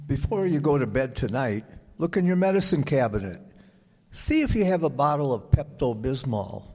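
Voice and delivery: ordinary delivery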